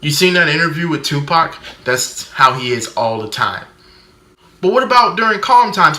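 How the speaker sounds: normal talking voice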